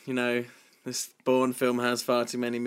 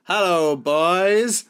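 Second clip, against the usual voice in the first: higher, louder sultry-camp hello; campy greeting; 7 semitones higher, 7 dB louder, much slower than usual. Pretend. Co-host setting up the game, camp. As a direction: purr it like a vamp.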